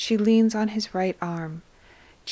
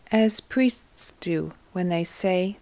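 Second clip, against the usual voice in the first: ordinary delivery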